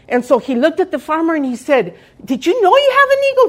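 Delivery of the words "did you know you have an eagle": surprised question. Retold or imitated. Missionary's quoted astonished question.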